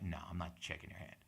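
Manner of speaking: low, very soft, dismissive; quiet refusal. Retold or imitated. Comic recounts refusing heckler's handshake, quiet and dismissive. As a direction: decline under your voice, retold.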